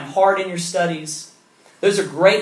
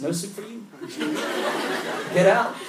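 mock soup Nazi God; 4 semitones higher, 4 dB quieter than usual. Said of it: "God is this person who's gonna look at us and say".

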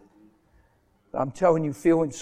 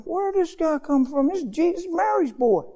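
high-pitched mock bewilderment; puzzled onlookers; 11 semitones higher than usual. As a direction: act out a baffled crowd. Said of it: "They're looking like".

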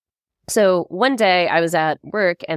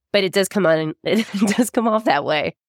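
ordinary talk, nothing marked

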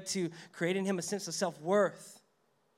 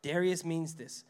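ordinary talk

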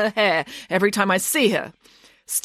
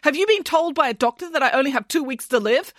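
high, insistent string of questions; mounting disbelief, pitched high; suddenly alarmed; 9 semitones higher than usual. A narrator's reading. Nanny suspicious of rival's sudden niceness, alarmed. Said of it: "suddenly alarmed".

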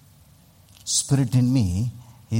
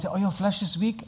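mock caller; 7 semitones higher, 5 dB quieter than usual. Voices people who call him up.

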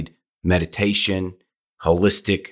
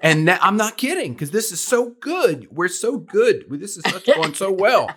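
excited; 10 semitones higher than usual. Real, not acted. Excited host praising the episode.

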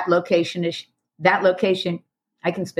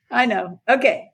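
ordinary talk

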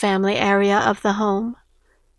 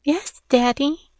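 put-on higher, meek girlish reply; obedient daughter voice; 5 semitones higher than usual. A narrator's reading. Daughter agrees to fetch father's beer, meek. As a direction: answer dutifully in a small, high voice.

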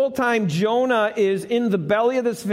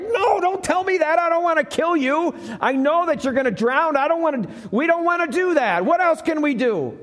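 higher, desperate pleading; pleading sailors; 7 semitones higher, faster than usual. Acted. Sailors refusing to throw Jonah overboard, higher desperate plea. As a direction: beg him not to make you do it.

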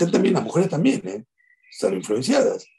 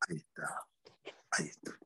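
hushed whisper; 7 semitones lower, 17 dB quieter than usual. Real, not acted. Showing the sunrise on camera.

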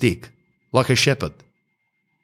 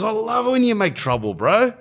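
a pitched-up, creepy-friendly host; 11 semitones higher than usual. Retold or imitated. Host urging guests to make trouble, in a high sugary voice. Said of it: "He goes".

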